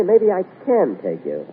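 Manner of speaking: normal talking voice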